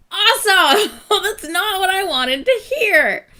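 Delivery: laughing through tears. Real, not acted. Breaks down while reacting.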